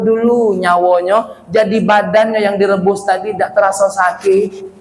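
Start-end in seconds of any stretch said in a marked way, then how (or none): none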